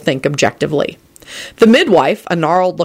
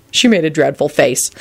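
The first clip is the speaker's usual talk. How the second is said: ordinary delivery, nothing marked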